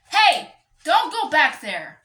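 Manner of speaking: startled loud warning at the pet; real shout at pet. Real, not acted. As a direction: shout to stop the ferret. Yelling at her ferret.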